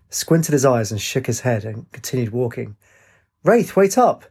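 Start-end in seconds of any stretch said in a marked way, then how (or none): 3.4-4.2 high, raised-voice call. A narrator's reading. Katie hurrying after Rafe, calling out. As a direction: call after someone who keeps walking.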